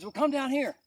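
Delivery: calling out. Retold or imitated. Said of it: "He goes".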